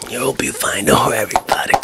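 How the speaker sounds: ordinary talk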